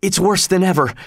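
panting, high and rushed; out of breath. A narrator's reading. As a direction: gasp it out, winded from running. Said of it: "all out of breath".

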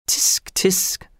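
whispery, disapproving tut-tut; murmured tutting. A narrator's reading. Executioner tutting at the tax objector, whispered murmur. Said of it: "murmured the executioner".

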